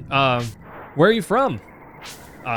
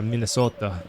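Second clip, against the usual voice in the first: understated imitation of a Minnesota accent; remembered Minnesota accent; 3 semitones lower, 3 dB quieter than usual. Streamer recalling how locals said it, low-key Minnesota accent. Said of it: "I remember the way they said".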